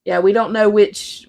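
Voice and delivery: ordinary talk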